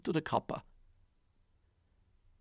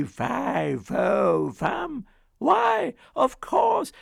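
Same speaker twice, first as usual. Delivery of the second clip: high, loud, exasperated cry; mocking wife; 12 semitones higher, 9 dB louder, much slower than usual. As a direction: throw his chant back at him. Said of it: "the ogre's wife said".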